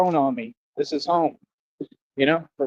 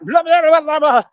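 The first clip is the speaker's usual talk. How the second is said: high, loud blah-blah babble of a TV anchor; mock news reporter; 10 semitones higher, 5 dB louder than usual. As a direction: rattle off blah blah like a pompous newsman. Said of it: "like the news reporter on Anchorman".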